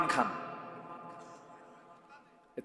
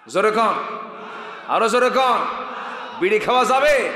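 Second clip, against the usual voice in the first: loud, raised-pitch call to the crowd; rousing shout; 6 semitones higher, 6 dB louder than usual. Real, not acted. Preacher goading the crowd to answer about smoking, rousing shout.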